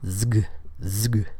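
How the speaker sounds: "zg" as a buzzed Z running into a hard G; isolated voiced cluster. Shown as a demonstration. Teacher isolating the sound for learners, "zg" with voiced Z. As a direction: buzz the Z straight into G for "zg". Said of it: "So it's zg".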